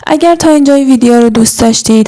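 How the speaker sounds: ordinary talk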